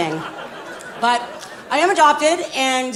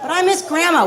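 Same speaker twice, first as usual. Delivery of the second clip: put-on whiny child; 8 semitones higher than usual. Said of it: "we were so whiny".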